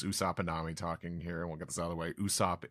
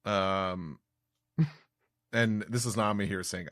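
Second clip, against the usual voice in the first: ordinary talk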